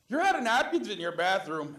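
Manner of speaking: high, very loud holler; screaming from the back. Retold or imitated. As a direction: yell a complaint from the back room. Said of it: "this woman screams from the back".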